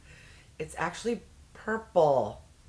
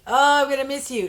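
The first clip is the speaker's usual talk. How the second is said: mock sentimental farewell; 8 semitones higher, 7 dB louder, faster than usual. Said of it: "you could have gone off with, like".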